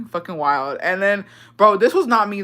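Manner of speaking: ordinary talk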